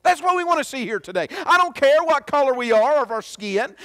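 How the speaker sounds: ordinary talk, nothing marked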